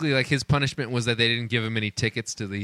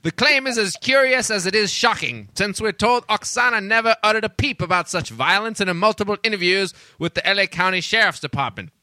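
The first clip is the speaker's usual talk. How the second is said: put-on old-timey newscaster; 5 semitones higher than usual. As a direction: announce it like a twenties newsreel. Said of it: "read it in an old-timey voice".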